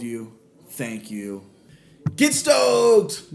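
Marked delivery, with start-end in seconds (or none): 2.2-3.3 high, loud hype shout; excited shout. Real, not acted. Host fires up his podcast listeners, loud excited shout.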